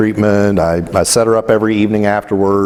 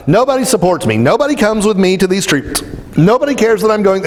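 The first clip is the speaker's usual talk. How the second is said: imitating a rant; 8 semitones higher than usual. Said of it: "she is ranting".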